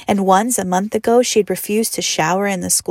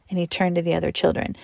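usual speaking voice

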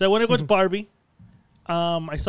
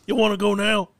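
mock cowboy challenge; 5 dB louder than usual. Mock cowboy picking a fight.

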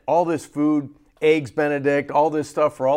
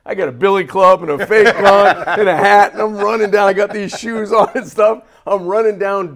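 laughing; 5 semitones higher, 8 dB louder than usual. Real, not acted. Laughing through the story.

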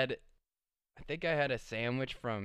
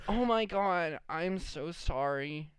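high, slow drawn-out apology; whiny mock-drunk apology; drunk girl apologizing; 5 semitones higher, slower than usual. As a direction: imitate a sorry, wasted girl. Voices drunk girl apologising after vomiting.

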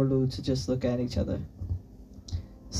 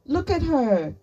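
high, raised-voice insistence; insistent arguing; 12 semitones higher, 4 dB louder, slower than usual. A narrator's reading. Chi-Chi urging her friend to consider Sunny, insistent. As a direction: press the point.

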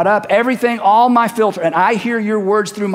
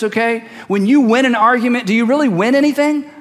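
usual speaking voice

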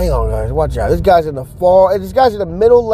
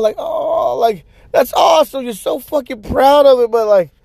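much higher, stretched disbelief; incredulous; 9 semitones higher than usual. Real, not acted. Talking about the friend's followers, high disbelief. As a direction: sound amazed it is so few.

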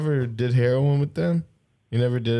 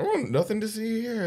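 innocent bystander voice; 9 semitones higher, 5 dB quieter than usual. Retold or imitated. Acting innocent at crime scene.

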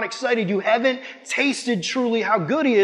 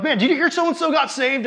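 high, laughing burst of good news; excited news; 8 semitones higher than usual. Retold or imitated. Telling a friend that someone got saved, excited and laughing. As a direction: blurt it out delighted, with a laugh.

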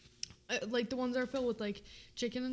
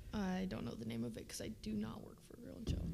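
joking whispered disclaimer; whispered aside; 3 semitones lower, 5 dB quieter than usual. Real, not acted. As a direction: whisper it under your breath.

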